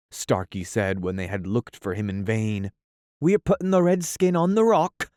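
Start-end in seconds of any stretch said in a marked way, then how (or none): 3.2-5.1 high, fast, loud call. Acted. Smee calling across the lagoon to his captain. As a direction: shout it across the water. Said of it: "Smee called out".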